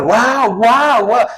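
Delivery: high, awed wow wow wow; high-pitched string of impressed wows; amazed wow. Shown as a demonstration. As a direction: gush like a dazzled tourist. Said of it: "Es un guau muy diferente".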